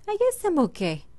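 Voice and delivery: high, airy relief; a squeaky I'm fine; high relieved. Real, not acted. Relieved after creeper blast.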